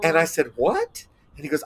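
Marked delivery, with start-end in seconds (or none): 0.5-1.0 sharp high disbelieving what; can't believe what he just heard; stunned what. Retold or imitated. Reacting to the Vice President's thanks, stunned and high. Retelling his own disbelief.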